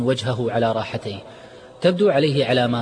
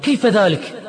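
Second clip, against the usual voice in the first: furious shout, much higher and louder; angry shout; 10 semitones higher, 6 dB louder than usual. Acted. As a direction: bark the question in outrage. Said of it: "صرخ عماد غاضبا".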